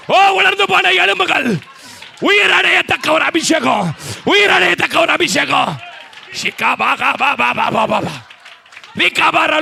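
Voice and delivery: shouted high prophetic preaching with tongues; preaching at full force. Acted. Pastor shouting prophecy over the congregation in full force. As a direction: cry out the prophecy over the church.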